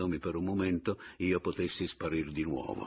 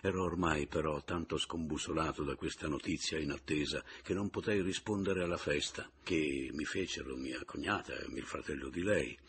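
ordinary delivery

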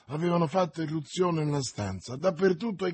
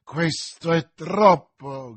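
slow, loud indignant exclamation; exasperated, swelling to a shout; outraged cry; 3 semitones lower, 6 dB louder, much slower than usual. A narrator's reading. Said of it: "gridò Buckingham".

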